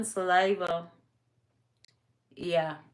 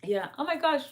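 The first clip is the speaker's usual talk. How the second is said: usual speaking voice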